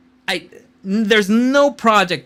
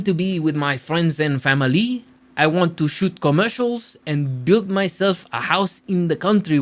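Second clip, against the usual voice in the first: mock French accent on the quote; hammed-up French accent; terrible French accent; 2 semitones lower than usual. Shown as a demonstration. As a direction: read it in a put-on French accent. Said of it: "I probably said that with a terrible accent".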